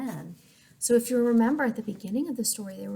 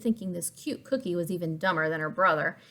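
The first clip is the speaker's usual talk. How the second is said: usual speaking voice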